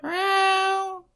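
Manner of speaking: high whiny meowing; high cat meow. Retold or imitated. Imitating the cat.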